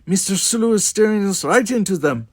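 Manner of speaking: high, loud, agitated accusation; great agitation. A narrator's reading. Chekhov complaining to captain about Sulu, greatly agitated. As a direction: blame the helmsman in a panic. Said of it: "Chekhov said with great agitation".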